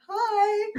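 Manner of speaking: squeaky starstruck hello; tongue-tied, nervous little hi; starstruck high hi. Retold or imitated. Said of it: "I was like".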